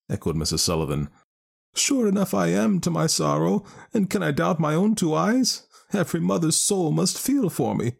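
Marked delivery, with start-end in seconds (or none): 1.7-7.9 high, distressed Irish mother; grieving mother voice. Acted. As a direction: lament her stolen child. Mother insists.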